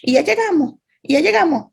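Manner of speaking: put-on high, repetitive childish nagging; child in the back seat whining to parents; impatient child. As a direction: keep pestering like a bored kid. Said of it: "y entonces empieza".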